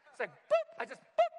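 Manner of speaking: high boop noise. Retold or imitated. Pastor miming his calf giving out, squeaky boop. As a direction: pop the sound way up high.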